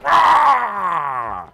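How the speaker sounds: high, loud cartoon "Wah!"; Wario's whiny squawk; Wario as Nixon. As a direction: shout it like Wario. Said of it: "He would just go".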